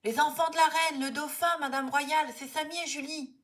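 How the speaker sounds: put-on high, loud frantic child's shout; boy straining his voice over the crowd; yelling at the top of his voice. A narrator's reading. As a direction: shout yourself hoarse to be heard. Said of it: "Louis s'égosille".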